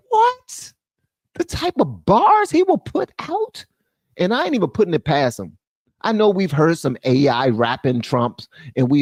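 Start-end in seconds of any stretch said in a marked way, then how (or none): 0.1-3.6 shrieked what, much higher; a cry of disbelief; high squeaked astonishment; incredulous what. Real, not acted. Imagining Trump as rapper.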